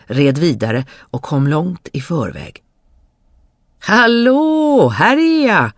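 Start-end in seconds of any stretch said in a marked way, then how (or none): 3.8-5.7 high, loud ringing hail; calling out. Acted. Dummerjöns calling the brothers a third time, loud. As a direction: shout it out with glee. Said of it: "ropade Dummerjöns igen".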